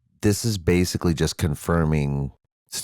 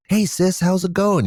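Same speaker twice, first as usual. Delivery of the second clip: very high, cheery mock mouse greeting; puppet mouse voice; 5 dB louder than usual. One mouse greets his sister, squeaky puppet voice. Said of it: "this one mouse who comes into".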